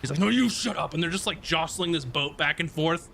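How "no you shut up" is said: high, mock-angry comeback; playfully acted squabble, pitched up; squabbling retort. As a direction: snipe back in a pretend shoving match. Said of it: "they sort of erupt".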